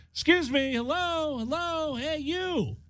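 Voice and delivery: high calling out hello to get her attention. Retold or imitated. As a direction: sing out high to someone behind glass. Calling out to woman in car.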